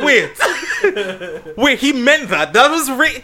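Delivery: high and loud, broken by laughter; laughing disbelief. Retold or imitated. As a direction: piece it together while cracking up. Friend realizing, retold laughing.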